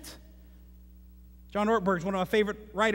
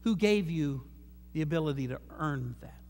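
low, soft, patient voice of God; the Lord gently correcting the preacher, low and soft; God's voice; 6 semitones lower, 6 dB quieter than usual. Retold or imitated. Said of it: "this is how he talks to me".

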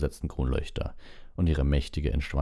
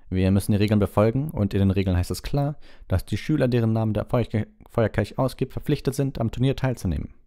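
raised pitch, firm official; curt, gruff; 6 semitones higher, 4 dB louder than usual. A narrator's reading. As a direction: lay down the rules brusquely. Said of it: "in seinem üblichen barschen Ton". (barscher Ton, erhobene Stimme)

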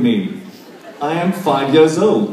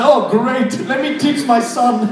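mock delight; 7 semitones higher than usual. Voices the sarcastic mother in audience.

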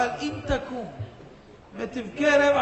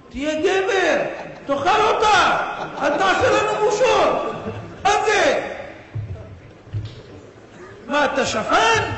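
louder, higher jeering taunts; mocking friends; 6 semitones higher, 5 dB louder than usual. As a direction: needle him like scornful buddies. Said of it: "התחילו לצחוק עליו". (חברים לועגים לנאשם שבוכה, בקול לגלגני רם)

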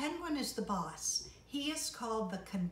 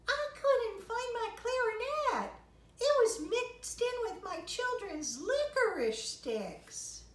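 very high, louder flamingo; 13 semitones higher, 5 dB louder than usual. Acted. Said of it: "said the flamingo".

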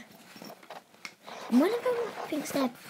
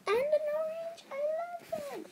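high delighted voice; 14 semitones higher, faster than usual. Acted. Character delight.

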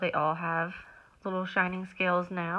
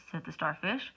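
usual speaking voice

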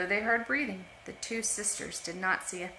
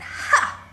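sharp breathy mocking "Ha!"; mocking laugh; 13 semitones higher, 10 dB louder than usual. Older sisters jeering at the scarred girl, mocking laugh. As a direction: scoff cruelly at the little sister. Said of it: "those two older sisters laughed at her".

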